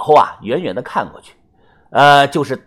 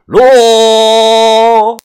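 one loud, high, sustained call; long drawn-out shout; 8 semitones higher, 9 dB louder than usual. A narrator's reading. Mr. Song orders the coffin lowered, long drawn-out shout. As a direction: hold the word long at full voice. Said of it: "他又拖着长音喊道".

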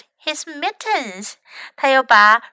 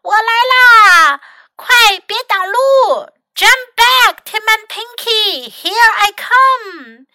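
shouting; 9 semitones higher, 6 dB louder than usual. A narrator's reading. Mac calls to Tim and Pinky below, shouting. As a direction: call out as if down a slope. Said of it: "麦克大喊道".

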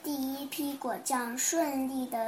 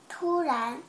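usual speaking voice

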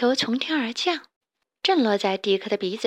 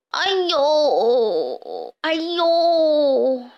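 long drawn-out theatrical groans; pained wailing; 5 semitones higher, 4 dB louder, much slower than usual. A narrator's reading. Stray pig feigning injury before the chicks, pained wailing. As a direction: wail loudly as if badly hurt. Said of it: "痛苦地大喊".

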